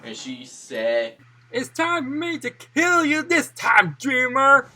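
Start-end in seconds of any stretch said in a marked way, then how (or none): 1.5-4.7 high, loud villain threat; furious. Acted. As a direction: shout the threat, rising in rage. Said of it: "Graciana was furious".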